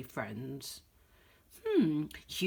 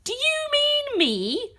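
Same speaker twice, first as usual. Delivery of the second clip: much higher, slow and bold; rat hero; 6 dB louder, slower than usual. Acted. Pat the rat confronting the dog, high and bold. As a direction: announce yourself as the rescuer. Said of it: "said Pat".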